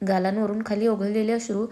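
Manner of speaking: ordinary delivery, nothing marked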